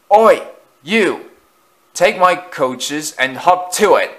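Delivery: high, loud, barking order to a rival engine; a pushy engine throwing its weight around; bossy engine. Acted. Loan engine orders Thomas about, loud and bossy. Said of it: "one engine would say".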